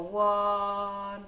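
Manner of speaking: very slow, stretched-out syllables; drawn out. Real, not acted. Cake maker measuring out colour, drawn out. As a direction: hold each word while concentrating. Drawing out words while measuring out.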